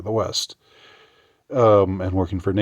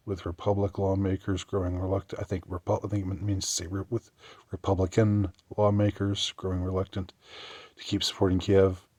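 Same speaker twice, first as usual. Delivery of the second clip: normal talking voice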